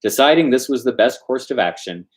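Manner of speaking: ordinary delivery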